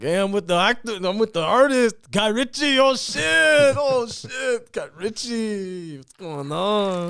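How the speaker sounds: high-pitched loud excitement, slowing at the end; Chong. Acted. Comic showing the high-pitched Chong one, loud and excited. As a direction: squeal it in a high, hyped-up stoner impression. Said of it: "more high pitched one".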